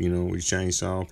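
ordinary talk

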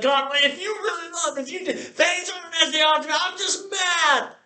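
an upset believer shouting at God while held; angry outburst. Retold or imitated. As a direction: yell at God in hurt anger. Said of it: "you're like".

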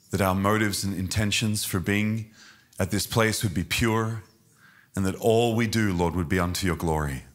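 earnest prayer voice, fading at the end; public prayer. Acted. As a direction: lead the church in prayer. Leads a prayer.

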